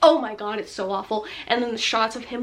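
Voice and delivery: usual speaking voice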